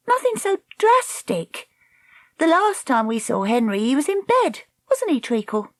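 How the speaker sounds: put-on high-pitched girl character voice; Dakota, cool young heroine. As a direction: answer airily in a high voice.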